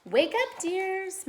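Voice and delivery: put-on a mother calling the kids awake; mother calls the sleeping children. A narrator's reading. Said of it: "Mother's voice called".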